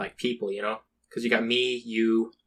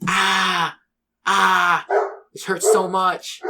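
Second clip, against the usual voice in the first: mock pained moan; 6 semitones higher, 4 dB louder, much slower than usual.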